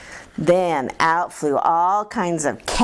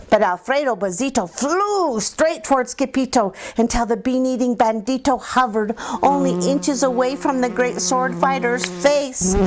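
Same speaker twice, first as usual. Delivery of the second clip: ordinary delivery, nothing marked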